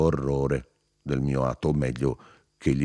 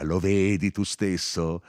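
higher, drawn-out cry; 6 semitones higher than usual. A narrator's reading. Narrator confronts paling Firmo, crying out. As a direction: press the point, slowly, voice raised. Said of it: "gli gridai allora".

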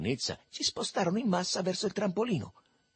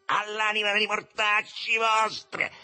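high, stretched-out furious shout; infuriated yell; 10 semitones higher, 3 dB louder, slower than usual. A narrator's reading. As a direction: yell the curse over a brawl. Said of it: "urlò Remo in mezzo alla mischia, premendosi infuriato". (urlo infuriato, acuto e strascicato)

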